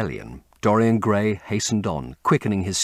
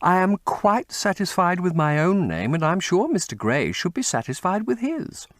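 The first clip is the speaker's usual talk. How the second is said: higher, light teasing lady's voice; playful Duchess; 10 semitones higher than usual. A narrator's reading. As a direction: tease him sweetly as the Duchess.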